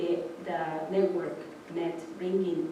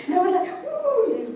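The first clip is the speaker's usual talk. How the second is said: laughing; 3 dB louder than usual. Real, not acted. Laughing recalling the jolt.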